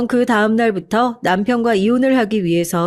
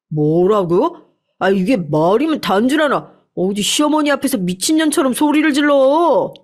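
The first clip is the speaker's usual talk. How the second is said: gruff husband's voice, mildly raised; angry husband; 3 semitones higher than usual. Acted. Husband furious at wife. (아내에게 화내는 남편, 약간 높인 목소리)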